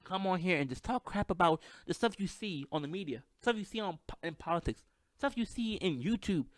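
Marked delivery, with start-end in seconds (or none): none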